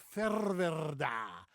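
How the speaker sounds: "Ferwerda" said slowly and loudly with a long trilled R; "Ferwerda" with a showy Frisian R, drawn out; exaggerated rolled R. Shown as a demonstration. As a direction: roll the R in "Ferwerda" hard to make it stand out. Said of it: "So I emphasize the rolling R".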